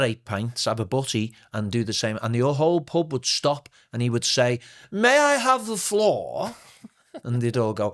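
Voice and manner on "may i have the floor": put-on high, slow and theatrical, like a drunk thespian; pompous retired actor voice; grand old actor. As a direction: announce it grandly to the pub. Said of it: "a retired aristocratic actor".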